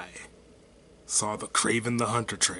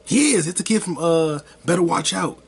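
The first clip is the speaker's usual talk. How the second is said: high, loud burst of recognition; thrilled, raised-voice realisation; excited recognition; 6 semitones higher, 8 dB louder than usual. Real, not acted. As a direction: blurt it out as it suddenly clicks. Suddenly recognises the child actor.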